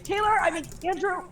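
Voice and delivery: high mock yell, correcting the name; high-pitched parental holler; imitating mom yelling. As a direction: call out like a flustered mother. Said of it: "which child she was yelling at".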